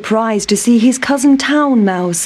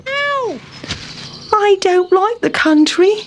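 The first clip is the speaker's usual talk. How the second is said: high, whiny mouse voice; a squeaky fussy complaint; Town Mouse complaining; 11 semitones higher than usual. A narrator's reading. Town Mouse fallen in wet grass, high whiny complaint. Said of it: "sighed Town Mouse".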